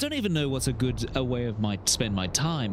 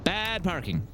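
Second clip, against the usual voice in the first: usual speaking voice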